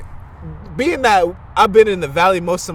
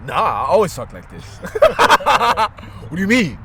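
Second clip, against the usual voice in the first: laughing mock defence. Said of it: "I'm the type".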